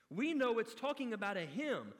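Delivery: ordinary delivery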